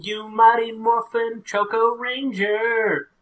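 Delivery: high, slow, loud hero chant; bright magical girl. Pretend. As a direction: belt it like a transformation call. Chanting a transformation call.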